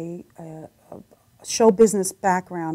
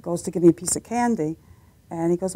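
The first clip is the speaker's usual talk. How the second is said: ordinary talk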